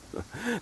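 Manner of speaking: quick sneering giggle; a bully's snide little laugh; mocking laugh. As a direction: laugh at your own insult. Thug mocks the clan.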